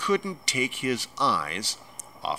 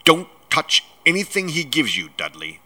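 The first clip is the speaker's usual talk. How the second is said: stern, sharp order to hands off; sharp warning; 5 semitones higher, 5 dB louder than usual. A narrator's reading. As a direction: snap it out sharply, higher and louder. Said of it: "Uncle Vernon said sharply".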